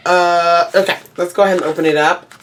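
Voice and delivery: ordinary talk, nothing marked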